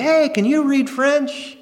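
high, slow, sing-out call; old lady's question. Retold or imitated. Blind old lady hails young men about French, high and drawn out. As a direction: hail them eagerly in imitation of an old lady. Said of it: "old lady would show up and say".

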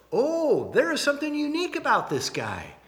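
high-pitched, impressed reaction; intrigued onlooker. Acted. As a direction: react as an onlooker struck by someone odd. Voicing onlookers' reaction to John.

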